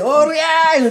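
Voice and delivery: long, high charging yell; huge-voiced battle cry. Showing the huge voice of his charge, long battle cry. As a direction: draw out a high war cry. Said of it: "でっかい声で".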